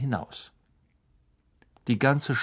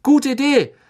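bright, eager and much higher; beaming; 11 semitones higher, 7 dB louder than usual. A narrator's reading. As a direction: grin through the question. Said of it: "fragte Fred Weasley strahlend".

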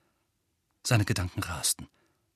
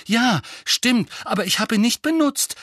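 loud, high-pitched protest; raised voice, indignant; angry; 12 semitones higher, 5 dB louder than usual. A narrator's reading. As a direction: protest hotly in your own defence. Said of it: "begann Harry zornig".

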